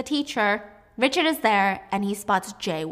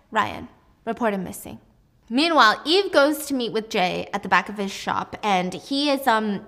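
ordinary talk

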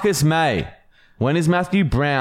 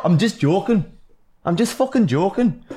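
higher-pitched imitated Geordie accent; mock Geordie accent; 7 semitones higher than usual. Co-host echoes while guessing the accent, mock Geordie accent. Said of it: "It's Geordie".

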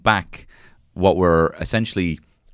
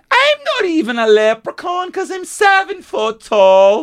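much higher, louder, slow silly shout; mock tall leprechaun; 4 dB louder, slower than usual. Answering the cohost, a leprechaun shouting much higher and louder. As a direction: yell it in a squeaky giant-leprechaun voice.

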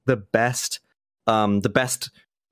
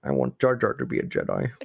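low, soft mock whine; whiny baby voice; 9 semitones lower, 3 dB quieter than usual. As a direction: grumble it like a sulky child. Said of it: "whiny baby version".

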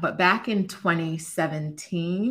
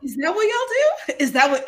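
high, loud incredulous question; can't believe what she heard; 12 semitones higher, 6 dB louder than usual. Retold or imitated. Retelling her shock at the therapist, high and loud.

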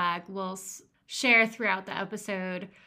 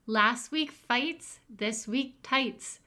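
slow title read; 3 semitones higher, much slower than usual. Acted. Reading episode title slowly.

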